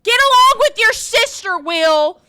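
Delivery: high loud stern order; dad scolding shout. Retold or imitated. Dad ordering son to behave, loud stern shout. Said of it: "And my dad said".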